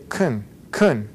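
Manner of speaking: "kun" with a barely audible vowel; weak, swallowed vowel in "kun"; reduced schwa. Shown as a demonstration. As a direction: go right from the C to the N in "kun". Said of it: "A that you can barely hear".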